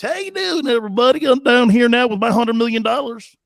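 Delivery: loud, high mock Louisiana accent; Brian Kelly, fake Southern accent. Host mocks coach working on his accents, hammed-up Southern accent. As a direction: overdo a phony Southern accent. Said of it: "working on all of his little accents".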